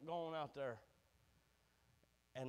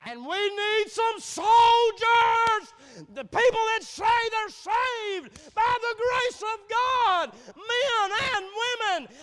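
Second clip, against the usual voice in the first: preaching at full force; 10 semitones higher, 10 dB louder than usual. Acted. Preacher calling the church to its post, shouted preaching. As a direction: cry out for soldiers, pulling the words long.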